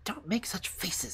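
high, soft, airy chiding; scolding robber girl. A narrator's reading. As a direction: chide softly in a high girl's voice. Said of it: "said the little robber girl".

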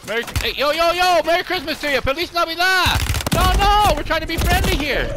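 high, loud holiday pleas; loud urgent friendly call; loud pleading greeting. Real, not acted. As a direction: shout friendly wishes to stop the attack. Pleading with attacker.

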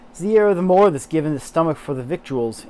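Yorkshire read from spelling; attempted Yorkshire accent. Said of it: "I'm terrible at accents".

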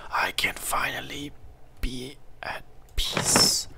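slow, hushed whisper of relief; dreamy whisper. Acted. Gamer finding a bed, dreamy relieved whisper. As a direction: whisper as if finally at rest.

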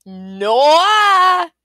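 long, high, swooping cry; shouted refusal. A narrator's reading. Little man rejecting the second guess, shouted. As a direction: holler it, stretching the vowel out.

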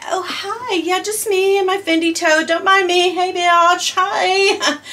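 breezy high-pitched passerby voice; mock casual greeting. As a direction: playfully pretend to bump into someone. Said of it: "I'm just like".

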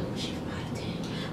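hushed, voiceless mock gossip; imitated murmur behind someone's back; whispered aside. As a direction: whisper it like locals clocking an outsider. Imagined locals remarking on an outsider.

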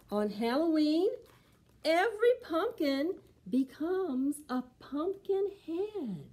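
slow, expressive picture book reading; storybook voice for preschoolers; reading to children. Acted. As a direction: read it slow and lively for little ones.

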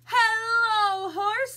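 drawn-out loud duck hello; calling out a greeting. Acted. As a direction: hail a friend high and loud as the duck. Duck calls to Horse in story.

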